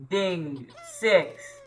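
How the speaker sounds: normal talking voice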